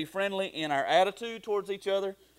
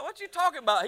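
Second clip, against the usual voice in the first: high-pitched, louder retold protest; mock bafflement; 12 semitones higher, 5 dB louder than usual. Son answering dad's accusation, high mock bafflement. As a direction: act out a baffled comeback, voice way up.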